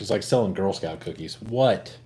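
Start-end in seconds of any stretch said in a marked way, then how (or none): none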